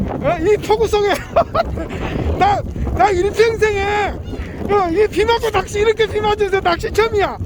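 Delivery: normal talking voice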